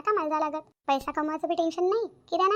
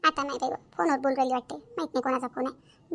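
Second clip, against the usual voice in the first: usual speaking voice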